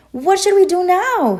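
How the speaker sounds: high, loud panicked holler. A narrator's reading. Duck hollers to Goose while running in circles, loud. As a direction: shout it in a panic. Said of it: "he hollered".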